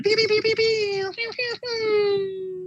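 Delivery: squeaky high laser zaps; high laser pew sounds. Pretend. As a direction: fire off cartoon laser blasts. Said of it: "you need some pew, pew, pews?".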